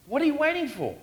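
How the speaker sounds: ordinary talk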